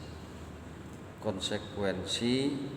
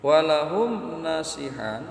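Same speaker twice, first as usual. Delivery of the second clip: slow, louder intoned Arabic phrase; Arabic recitation; 6 semitones higher, 7 dB louder, slower than usual. Acted. Teacher quoting the kitab to the congregation, slow and intoned. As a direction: draw out the kitab words with weight.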